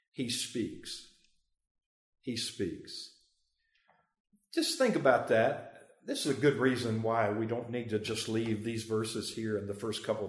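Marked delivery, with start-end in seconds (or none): none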